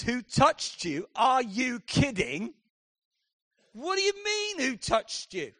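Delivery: incredulous, drawn-out retort to Jesus; a disciple scoffing at the question; exasperated disciple. Retold or imitated. Disciple scoffing at Jesus in the crowd, exasperated.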